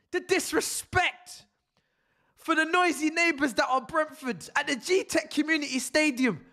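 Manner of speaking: high-pitched indignant ranting; high outraged rant. Real, not acted. Fan rants, outraged, about the rival's disrespect. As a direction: sound scandalised and wounded.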